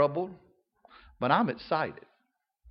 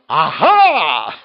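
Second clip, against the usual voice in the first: mock gloating aha; 10 dB louder than usual. Church critic gloating over a fallen preacher, loud gotcha. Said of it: "you won't be going".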